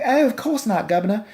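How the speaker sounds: high, hammed-up Cockney; mock British. British family answering Ed's request to play, high mock British accent. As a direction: put on a silly English accent.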